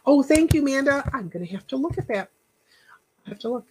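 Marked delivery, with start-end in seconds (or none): none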